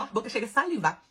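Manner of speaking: ordinary delivery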